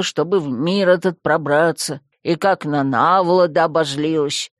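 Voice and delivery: gloomy skull lecturing; grumpy skull. Acted. Skull scolds heroine. (ворчливый низкий голос)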